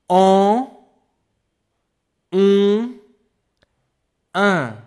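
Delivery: en, on, un said high and loud, one by one; teacher reviewing the nasal vowels; three nasal vowels. Shown as a demonstration. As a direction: set the three nasal vowels apart clearly. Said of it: "one more time".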